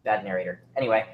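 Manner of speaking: usual speaking voice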